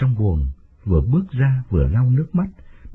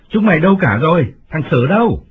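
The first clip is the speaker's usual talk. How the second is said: louder call, higher, to the servants; calling out loudly; 7 semitones higher, 4 dB louder than usual. A narrator's reading. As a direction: master shouting for his servants. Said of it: "gọi lớn". (gọi lớn)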